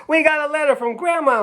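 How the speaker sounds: put-on high, eager child's announcement; like a kid bursting into the kitchen; excited kid. Children telling the family about Grandma's letter, high and excited.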